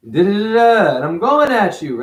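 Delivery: loud, high mock argument; angry. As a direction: go at him loud and heated. Acting out an argument.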